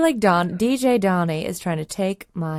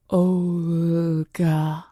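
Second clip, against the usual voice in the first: eerie reveal. Acted. Eerie backwards message reveal.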